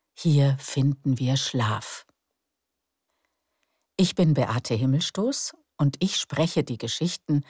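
ordinary delivery

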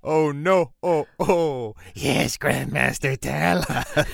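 awed villager voice, slow; hushed creepy legend telling. Pretend. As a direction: beg the Grandmaster to speak. Joins the mock ritual.

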